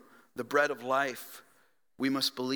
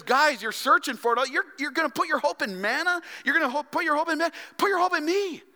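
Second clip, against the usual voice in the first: higher, louder, urgent plea; Jesus pleading; 11 semitones higher, 5 dB louder, faster than usual. Retold or imitated. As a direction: press it on them with rising urgency. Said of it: "He says".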